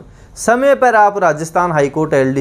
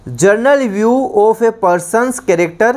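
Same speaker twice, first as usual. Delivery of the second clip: very slow, each English word spaced for note-taking; slow dictation; 3 semitones higher, much slower than usual. Shown as a demonstration. Teacher dictating to students, very slow and spaced. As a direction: read the definition out word by word, slowly.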